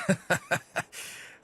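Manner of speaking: breathy high-pitched giggle. Acted. Narrator giggling at the Mountbatten name. After joke about the name.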